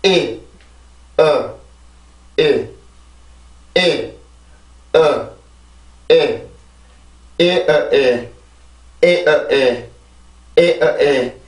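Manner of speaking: 에, 어, 에 strong beat then two weak; corrected vowel rhythm. Shown as a demonstration. Teacher redoing the vowel rhythm with schwa. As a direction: chant 에 big, 어 and 에 small. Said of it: "다시 한번 해볼게요".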